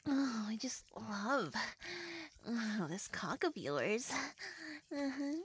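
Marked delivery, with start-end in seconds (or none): 0.1-4.1 slow, soft whisper; breathy loving whisper. Acted. Intimate scene, whispered.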